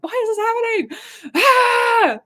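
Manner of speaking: high, loud anguished yell up at the heavens; retold yell of despair ending in a raw scream; yelling at the sky. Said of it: "I can yell at the sky and be like".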